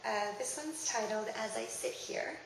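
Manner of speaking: ordinary talk, nothing marked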